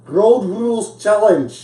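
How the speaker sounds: slow, high Scottish accent; bad Scottish accent. Shown as a demonstration. Host trying a suggested phrase, slow bad Scottish accent. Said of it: "fun thing to say in a Scottish accent".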